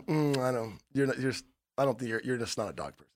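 low, very soft, trailing-off needling; hushed mock condescension; dismissive doubt. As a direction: murmur it, quietly unconvinced.

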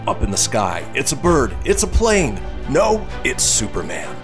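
announcer intro. Acted. Show opening.

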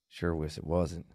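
soft and low, "wasn't" trailing off; quiet. Shown as a demonstration. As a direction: let "wasn't" fade away. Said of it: "Can I, do you mind?".

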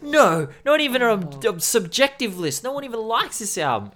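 high-pitched, exasperated retelling; insistent mock protest. Friend insists no one likes this album, mock exasperation. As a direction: imitate a friend refusing to budge.

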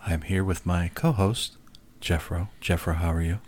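low, soft late-night radio host. Acted. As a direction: purr it like an old AM deejay. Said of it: "our A M radio voices today".

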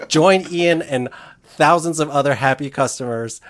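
oversell it with a sarcastic grin. Pretend. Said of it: "the sarcasm in the voice".

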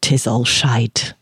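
slow, low Scottish pronouncement; Scottish accent. A narrator's reading. Kilted man confirming the disaster, slow Scottish accent. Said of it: "he said fervently in a thick Scottish accent".

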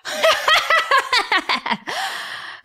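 high cackling hyena laugh. Acted. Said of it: "hyena laugh".

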